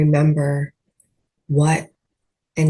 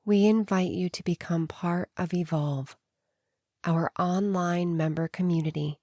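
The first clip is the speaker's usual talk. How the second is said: softer, hushed ad read for the community; a quietly inviting announcement; promo read; 10 dB quieter than usual. Acted.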